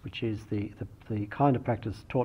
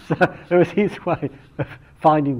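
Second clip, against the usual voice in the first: laughing; 5 semitones higher, 9 dB louder than usual. Real, not acted. Still laughing after story.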